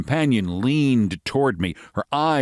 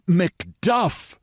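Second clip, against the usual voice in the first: prompt; 9 semitones higher than usual. Acted. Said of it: "she said promptly".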